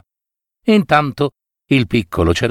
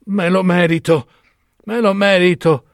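dying lament; 6 semitones higher than usual. Acted. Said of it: "mentre stava proprio per morire".

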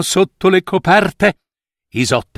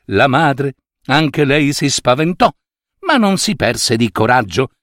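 plain reading voice

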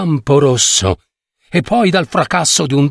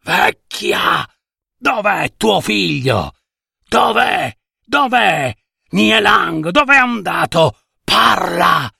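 put-on higher, furious yelling at the old woman; Zhou's angry shout; 5 semitones higher than usual. Zhou the rich man bullying old mother Nie, furious shout. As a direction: bark the demands over and over. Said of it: "e gridò".

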